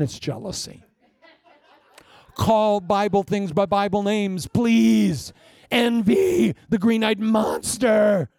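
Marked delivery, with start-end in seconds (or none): 2.3-8.3 loud, slow, high preaching; full-force preaching. Acted. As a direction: hammer each phrase home to the church. Preaching peak on envy.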